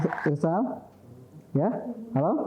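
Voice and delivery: faint, cutting-out phone voice; crackly cordless phone. Real, not acted. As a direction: sound like a dropping call. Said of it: "teleponnya putus-putus nih".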